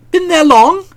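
high breezy question; light chatty query; chatty Bertie. Acted. Bertie making small talk.

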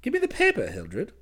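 breezy, obliging request; pleasant cousin. A narrator's reading. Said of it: "he said pleasantly".

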